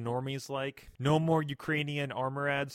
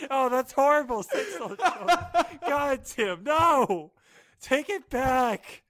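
much higher, louder, laughing protest; laughing horror; 11 semitones higher, 8 dB louder, slower than usual. Real, not acted. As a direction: shriek through laughter at the joke. Friend horrified by joke.

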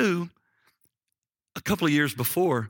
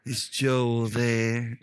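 very slow, drawn-out phone inquiry; imitated woman caller; much slower than usual. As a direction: stretch out each word, mimicking the caller. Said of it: "that's how she said, this lady said that".

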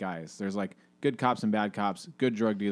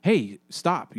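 mild call out; 7 semitones higher, 5 dB louder than usual. Retold or imitated. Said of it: "is like".

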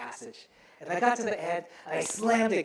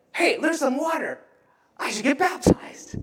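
higher-pitched eager outburst; excited; 7 semitones higher, 4 dB louder than usual. Acted. New believer spotting water by the road, excited. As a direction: blurt it out with delight. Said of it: "this guy is so excited".

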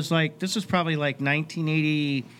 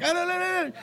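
mock British singer babble, pitched way up; imitating a British accent with high nonsense sounds; 12 semitones higher than usual. Fan imitating the singer's British accent, high and silly. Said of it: "he's got the accent".

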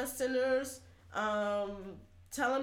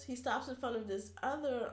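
normal talking voice

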